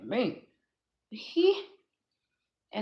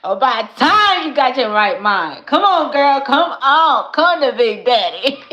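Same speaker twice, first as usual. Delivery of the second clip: loud, high, laughing call of her future man; imagined man calling her; 5 semitones higher, 16 dB louder than usual. Retold or imitated. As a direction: boom out his welcome through laughter. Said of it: "He gonna be like".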